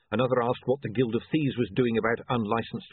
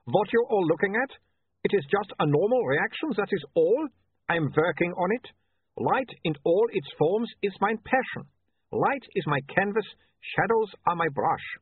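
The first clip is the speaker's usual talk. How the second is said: sternly; 10 semitones higher than usual. Acted. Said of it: "he said sternly".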